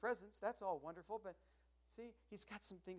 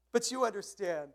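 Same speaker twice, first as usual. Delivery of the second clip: laughing; 7 semitones higher, 13 dB louder than usual. Real, not acted. Laugh after joke.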